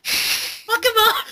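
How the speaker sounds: very high, drawn-out cheer; mock squealing girl. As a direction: egg a friend on in a squeal. Joins in mimicking the squealing girls.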